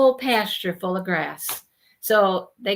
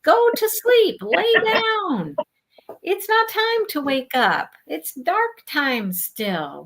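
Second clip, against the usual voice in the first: higher, louder pleading scold; scolding the cows; 9 semitones higher, 3 dB louder than usual. Retold or imitated. Scolding the mooing cows before dawn, high and loud. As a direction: tell the noisy cows off like naughty kids. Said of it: "scolding the cows at dawn".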